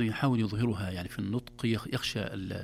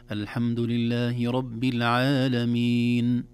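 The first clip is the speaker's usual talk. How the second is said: slow, drawn-out verse recitation; Quran recitation; 4 dB louder, much slower than usual. Shown as a demonstration. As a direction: recite it measured, holding the long vowels. Recites example from Al-Fatiha. (تلاوة مرتلة)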